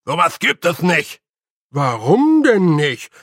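loud, boisterous pixie men bickering; cheeky loud Feegle banter; rowdy little Feegles. Acted. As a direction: squabble like tiny rough fighters over pig pie. Feegles chatter on the roof.

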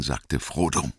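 plain reading voice

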